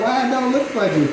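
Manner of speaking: confused viewer. Pretend.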